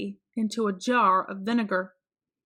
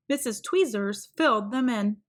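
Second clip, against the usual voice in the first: ordinary delivery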